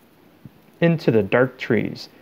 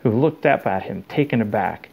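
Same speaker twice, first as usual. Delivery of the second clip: ordinary delivery, nothing marked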